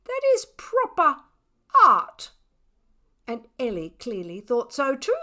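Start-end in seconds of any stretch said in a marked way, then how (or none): 0.0-2.3 haughty, loud and high-flown; lofty declaration. A narrator's reading. Cat telling the artist with a lofty stare, haughty and loud. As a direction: pronounce it like a proud artist. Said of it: "a lofty stare".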